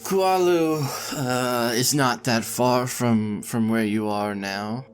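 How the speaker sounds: character voice. Acted. GM voicing NPC greeting.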